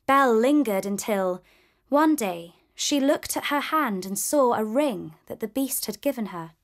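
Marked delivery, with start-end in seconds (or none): none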